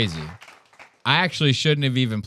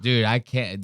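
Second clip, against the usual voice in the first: normal talking voice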